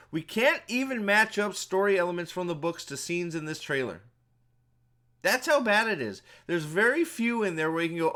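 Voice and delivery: loud rant. Real, not acted. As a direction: vent at full volume at the audience. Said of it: "I'm sorry that was so loud".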